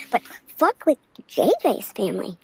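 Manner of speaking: normal talking voice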